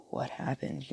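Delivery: stunned hushed whisper; dazed whisper. Acted. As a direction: breathe the question out in shock. Dazed after waking changed.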